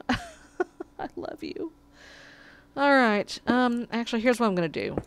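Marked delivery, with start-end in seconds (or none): 0.1-1.7 slow, high, soft coo with a laugh. Real, not acted. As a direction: melt over the cat. Cooing to cat with laugh.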